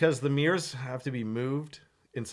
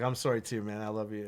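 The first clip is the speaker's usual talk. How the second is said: soft tender reply; 6 semitones lower, 8 dB quieter than usual. Retold or imitated. Tender apology retold.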